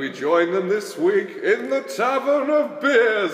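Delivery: slow, drawn-out show opener; announcer intro. Acted. Host welcoming listeners to the show, slow announcer read.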